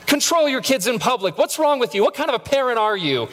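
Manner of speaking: high, indignant scolding; mock scolding. Judging parents at Walmart, high indignant scolding.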